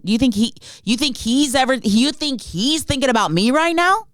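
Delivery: normal talking voice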